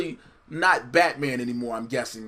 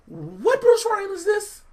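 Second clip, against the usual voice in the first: high, drawn-out mock disbelief; incredulous outrage; 4 dB louder, slower than usual. Co-host mocking Bruce Wayne's emoji texting, high incredulous outrage. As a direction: stretch it out high in comic outrage.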